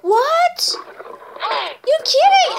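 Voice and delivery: high, loud, surprised outcry; mock shock. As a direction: shout it out in playful disbelief. Pretend shock at lion.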